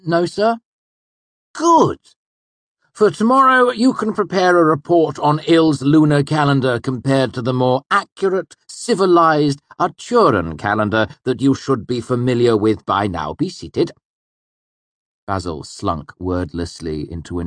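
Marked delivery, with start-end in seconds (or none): 0.0-0.6 put-on much higher, louder awkward boy; nervous student. A narrator's reading. Basil answers. 1.5-13.9 high, loud, stern master; stern Hemme. A narrator's reading. Hemme punishes Basil.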